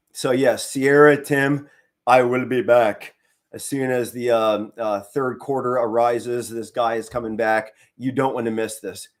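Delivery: normal talking voice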